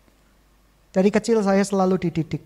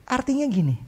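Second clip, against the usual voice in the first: normal talking voice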